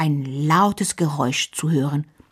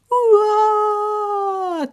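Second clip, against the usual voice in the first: high loud wailing cry; a toddler's howling wail; baby wail; 3 dB louder than usual. A narrator's reading. Little Tiki Taka bawling in the kitchen, loud wail. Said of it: "Tiki Taka weint".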